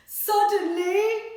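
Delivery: high, loud, drawn-out suddenly; big suspenseful reveal; dramatic suddenly. Acted. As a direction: make the kids jump on it. Dramatic story word.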